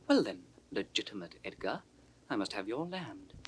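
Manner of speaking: usual speaking voice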